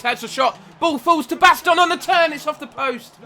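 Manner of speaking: rising to a high, loud commentary yell; excited shout. Real, not acted. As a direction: erupt as the shot flies off the post. Shot hits the post, live commentary.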